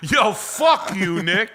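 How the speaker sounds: loud, high-pitched comic outburst; mock shouting rant. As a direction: blow up in pretend outrage. Mock outburst about free gifts.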